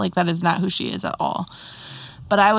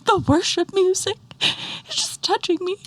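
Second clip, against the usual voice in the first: put-on much higher, small child's voice; little daughter; 11 semitones higher than usual. Mom imitating her crying toddler in a high voice. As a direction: voice the tearful little girl. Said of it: "And she's like".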